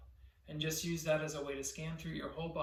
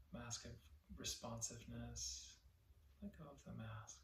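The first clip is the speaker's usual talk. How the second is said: guided meditation; 3 semitones lower, 13 dB quieter than usual. Real, not acted. Teacher leading listeners to relax their body, soft guided meditation. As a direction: softly guide them to let go, phrase by phrase.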